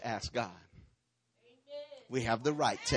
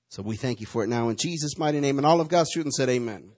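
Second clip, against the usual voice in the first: louder, earnest praying; pulpit prayer; 6 dB louder than usual. Acted. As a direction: pray over the church. Leads a prayer.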